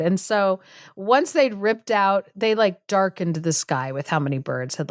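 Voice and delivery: ordinary delivery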